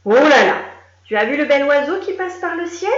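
high, loud mock wonder; fake amazement. Referee tricking Lily with loud mock amazement. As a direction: pretend to spot a bird.

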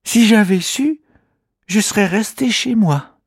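high small voice, regretful; rueful little creature. Acted. Kimamila regretting he came, rueful creature voice. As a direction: grumble to yourself. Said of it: "pense le petit bonhomme".

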